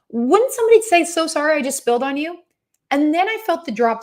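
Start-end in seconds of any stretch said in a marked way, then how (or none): 0.1-2.4 indignant disbelief. Retold or imitated. Indignant about dripping on plane.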